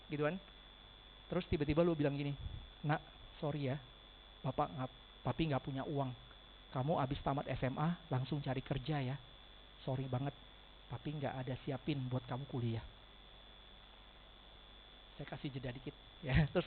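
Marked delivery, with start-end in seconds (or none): 2.8-12.8 softer, lower, slowing into the bad news; somber storytelling voice. Shown as a demonstration. Insurance agent voicing a broke father telling his child. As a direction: drop the voice and pull at the heart. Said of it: "Nada suara turun dikit, tempo lambat dikit, masukin ke hati".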